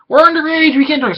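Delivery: high, mocking voice of underage kids; mock whiny kids. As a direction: squeak it like prim students protesting. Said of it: "everyone's like".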